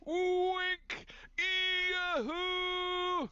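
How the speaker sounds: very high, shouted announcer call; game show announcer shout. Pretend. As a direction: belt it like a TV announcer. Shouting a game show host's name.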